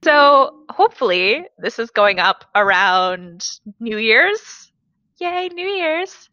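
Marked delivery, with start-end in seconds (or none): 5.2-6.3 sarcastic cheer. Acted. Sarcastic cheer about New Year.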